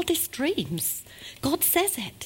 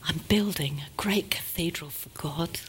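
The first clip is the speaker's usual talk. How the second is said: softer, reverent whisper; awed whisper; 5 semitones lower, 6 dB quieter than usual. A narrator's reading. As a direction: breathe it with wonder. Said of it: "And he exclaimed".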